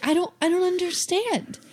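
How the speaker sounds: high-pitched, mildly bewildered retelling; baffled. Retold or imitated. Wife recalls snubbed cookie delivery, baffled.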